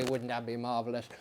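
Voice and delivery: ordinary delivery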